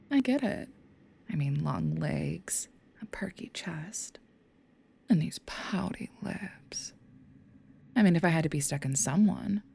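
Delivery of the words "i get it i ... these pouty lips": drawn-out, breathy tease; slow seductive whisper. Acted. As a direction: savor each word like a taunt. Demon teasingly lists body's features.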